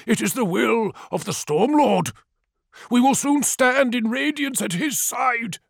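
high, fervent elder's voice; zealous elder. A narrator's reading. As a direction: speak with devout certainty. Narrator voices elder Tefra.

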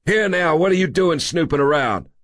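high, sharp voice of an irate man; indignant, high-voiced little busybody; sharp challenge. A narrator's reading. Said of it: "startled by a sharp voice".

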